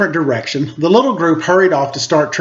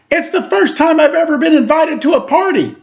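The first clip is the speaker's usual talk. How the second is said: much higher, bursting with joy; excited; 11 semitones higher than usual. Acted. Charlie Brown telling Lucy his first invitation, high and gushing. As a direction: gush like it's the best day ever.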